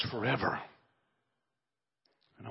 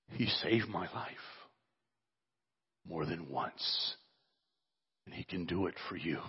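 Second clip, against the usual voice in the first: ordinary talk, nothing marked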